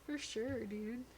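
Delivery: laid-back surfer drawl; surfer dude accent. Pretend. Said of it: "sound like a stereotypical surfer".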